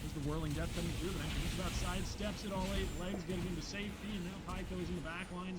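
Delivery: high, excited caster call; play-by-play shouting. Shown as a demonstration. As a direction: call the action like live play-by-play. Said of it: "Play-by-play is easy".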